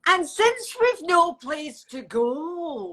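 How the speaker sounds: slow Scottish lilt again; Scottish accent. Shown as a demonstration. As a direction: demonstrate the Scottish line slowly. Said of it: "One more time, please".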